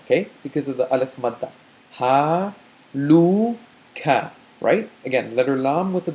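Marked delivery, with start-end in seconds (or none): 1.9-4.3 "Ha, lu, ka" as separate Arabic syllables; Arabic syllables sounded out. Shown as a demonstration. Teacher sounding out the word syllable by syllable. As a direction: sound out "Ha, lu, ka" one by one. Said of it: "let's sound out each of these parts".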